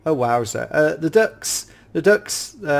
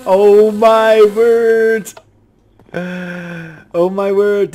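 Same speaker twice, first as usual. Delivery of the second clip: slow, drawn-out awestruck exclamation; awed gasp; 7 semitones higher, 8 dB louder, much slower than usual. Real, not acted. Gamer seeing wasps in the building, slow stunned awe. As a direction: stretch every word out in disbelief.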